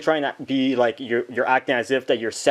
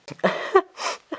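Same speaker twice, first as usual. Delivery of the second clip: high breathy laugh. Acted. Bonnie laughs during the Candy memories.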